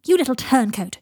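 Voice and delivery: loud cry of betrayal; indignant shout. Acted. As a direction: shout it at a little traitor. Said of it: "shouted Draco".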